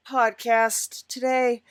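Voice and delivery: normal talking voice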